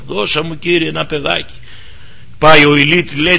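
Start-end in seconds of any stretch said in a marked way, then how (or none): 0.1-1.5 soft murmured plea, much softer; murmured prayer. Retold or imitated. Anna murmuring her prayer for a child, softly. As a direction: murmur it under the breath like a prayer. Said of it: "μουρμούριζε προσευχή".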